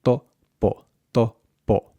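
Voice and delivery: To, po split into unaspirated syllables; tongue on teeth for To; muted, puffless To, po; Spanish dental t. Shown as a demonstration. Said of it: "tongue against the back of your teeth".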